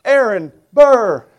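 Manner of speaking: slow, loud, straining through a full mouth; emphatic, mouth full. Retold or imitated. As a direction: force each word out through the sandwich. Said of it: "he emphatically is trying to say the words".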